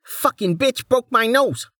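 high, whiny complaining man; angry thug voice. Acted.

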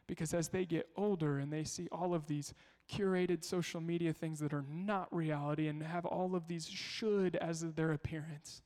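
ordinary talk

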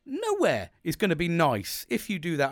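re-enacted heated reply; indignant comeback. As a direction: throw it back at them. Retold angry comeback in quarrel.

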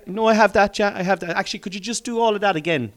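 high, loud acted fluster; mock panicked learner; flustered pupil. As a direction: hurriedly cover for being lost. Acts out flustered self being taught.

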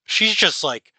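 ordinary talk

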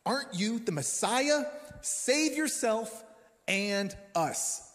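high-pitched, drawn-out taunt; mocking insults. Criminal on the cross hurling insults at Jesus, mocking. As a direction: jeer it slowly, stretching the words up high. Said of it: "hurled insults at".

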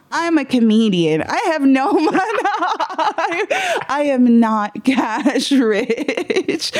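high, squeaky comic protest; hammed-up outraged retort, climbing in pitch; mock indignation. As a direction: stretch the words out in a high, giggly whine.

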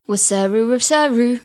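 wasaru as a reversed sound; imitating reversed speech. Shown as a demonstration. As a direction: mimic backwards clip as wasaru.

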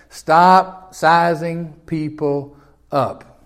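very slow, falling on "stop"; slow deliberate repeat. Real, not acted. Preacher repeating the command very slowly to the congregation. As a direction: spell it out one word at a time.

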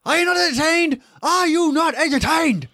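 high-pitched mock shout to a crowd; mock arena shout. Quoting Gladiator's arena outburst.

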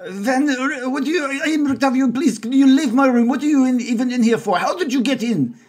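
high, rushed, indignant Frenchman; flustered French chef. Acted. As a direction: fluster and shoo them out. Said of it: "And he says".

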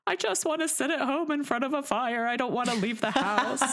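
high mock-whiny plea; whiny reluctance. Mother imitating herself refusing the snow, high mock whine. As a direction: whine it like a kid who won't go out. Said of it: "And I'm just like".

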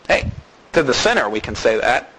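normal talking voice

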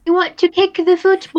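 put-on a playful stab at a British accent; mock British accent. To her co-host, teasing, in a hammed-up British accent.

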